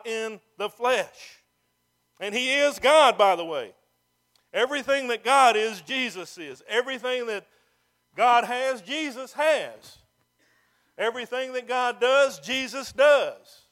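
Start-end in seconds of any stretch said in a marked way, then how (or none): 2.2-13.7 loud, high, emphatic pulpit delivery; forceful preaching. Real, not acted. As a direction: drive each phrase home, drawing out the endings.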